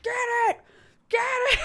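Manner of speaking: high, loud, frantic yelling; frantic shout. Retold or imitated. Dad yelling at mom to catch the bird, frantic and high. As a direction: bark the order over and over in a panic.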